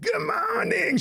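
high shouted good morning; a sudden bright wake-up call; imitating Mike. Imitating President Mike waking the napping girlfriend. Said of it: "President Mike was like".

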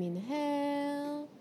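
usual speaking voice

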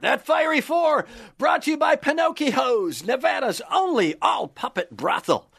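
punchy sponsor announcer read. Acted. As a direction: sell it like a radio ad.

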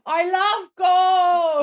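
put-on very high, loud, slow Dutch-accented shout; Goldmember impression. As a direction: bellow it like a greedy cartoon Dutchman. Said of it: "A Dutch voice?".

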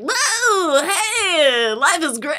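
high, drawn-out mock cheering; mock elation. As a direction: whoop like an absurdly carefree person. Quoting imaginary carefree happy people.

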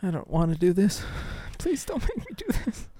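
high, whimpering mock plea; mock pleading. Begging co-host not to start with a downvote, mock pleading. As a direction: beg like a reluctant kid, hammed-up.

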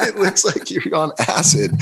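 laughing through the words; dad laughing at his own raccoon story. Real, not acted. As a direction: crack up while telling it.

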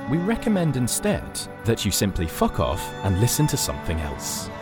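ordinary talk

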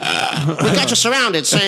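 mock thirties gangster with the cops' line; 1930s gangster. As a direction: drag it out like a hammed-up wiseguy. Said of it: "like a nineteen thirties gangster".

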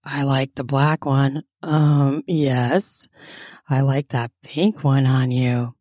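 low husky character voice; huskier voice. A narrator's reading. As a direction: drop the pitch, a little husky. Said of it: "in a somewhat huskier voice".

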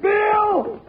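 high-pitched cry of a man hit; alarmed shout. Acted. Deputy to his partner, thinks he's shot, high alarmed shout. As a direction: shout for help as if wounded. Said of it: "Jingles shouted".

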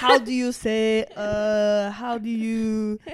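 slow, drawn-out mock groping for words; searching for words. Friend mimicking the Frenchman stuck for English, slow and halting. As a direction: stall on each phrase, imitating a stuck speaker. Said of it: "He was trying to explain to us".

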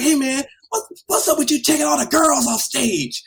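high, loud angry confrontation; indignant mock yell; retold yelling. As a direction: act out being chewed out by an outraged performer. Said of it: "I'm getting yelled at by [name]".

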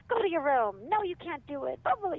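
loud, snapping parent, played for contrast; mock barking parent. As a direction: bark the order like a fed-up parent. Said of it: "you bark at them".

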